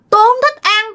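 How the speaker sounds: high-pitched, pouting refusal; contrary spirit. Retold or imitated. Spirit refuses the meal offered to it, high and contrary. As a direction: refuse in a high ghostly character voice.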